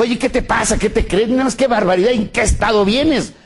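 reproche aigu et indigné du coq; coq fâché. Retold or imitated. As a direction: gronde la poule comme un coq en colère. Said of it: "Y el gallo se enojó".

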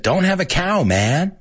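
high-pitched cartoon kid catchphrase; Bart Simpson. Host quoting the cartoon catchphrase, high cartoon-kid voice. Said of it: "Bart Simpson who said".

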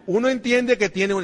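ordinary talk, nothing marked

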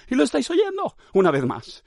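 high-pitched insistence; mock exasperation. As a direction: protest as if nobody gets it. Exasperated mock rant at listeners.

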